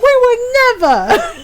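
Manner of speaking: high, loud, drawn-out fake protest; hammed-up scandalized denial; mock innocence. Friends promising not to embarrass her, exaggerated mock innocence.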